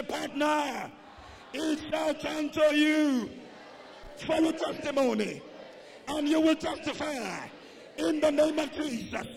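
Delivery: high, loud drawn-out declaration; shouted church proclamation, slow on the big phrases; full-force preaching. Real, not acted. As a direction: preach it out loud for the congregation to repeat. Leads congregation in declaration.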